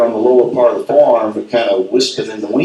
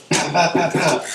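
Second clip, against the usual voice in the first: higher, soft pow pow gunfire; imitated gunshots; 5 semitones higher, 4 dB quieter than usual. Hunter imitating the rifles again. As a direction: pop out quick mock shots.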